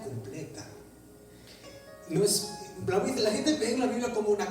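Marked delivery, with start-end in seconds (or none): none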